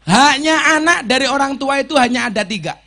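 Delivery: loud, high hadith recitation; preaching. Acted. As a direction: proclaim the hadith to the congregation. Preaching emphasis.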